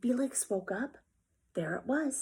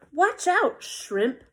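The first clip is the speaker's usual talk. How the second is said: taunting bully; 5 semitones higher, 5 dB louder, much slower than usual. Acted.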